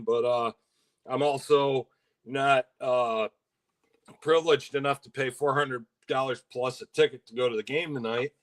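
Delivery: normal talking voice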